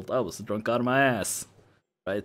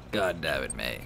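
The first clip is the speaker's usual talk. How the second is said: low, softer, weary voice; exasperated under the breath; fed-up friend; 7 semitones lower, 4 dB quieter than usual. Acted. Sober friend scolding drunk May, low exasperation.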